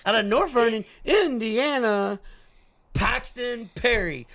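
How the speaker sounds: slow, high race announcer with drawn-out name; sprint car announcer. Shown as a demonstration. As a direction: stretch every syllable for the crowd. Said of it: "that's how they do the Chili Bowl".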